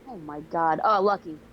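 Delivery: ordinary delivery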